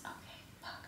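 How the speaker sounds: hushed whispered curse. Real, not acted. Vlogger reading the menu alone, whispered curse. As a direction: breathe it out under your breath.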